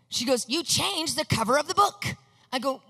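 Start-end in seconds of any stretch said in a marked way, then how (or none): 0.5-2.2 mild mock yell. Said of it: "She starts kind of yelling at me".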